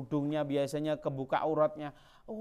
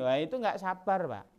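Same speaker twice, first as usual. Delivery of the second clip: ordinary talk, nothing marked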